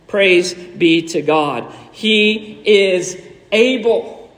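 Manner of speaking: low, slow, weighty declaration; solemn proclamation. Real, not acted. Preacher praises God before the congregation, low and slowly emphatic. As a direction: let each word stand on its own.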